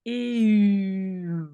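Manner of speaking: "Eu" as a long e sliding into u; sound-blending demo. Shown as a demonstration. Teacher analyses the sound for learners, drawn-out demo. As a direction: stretch "Eu" slowly from e to u. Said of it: "le long e, puis u".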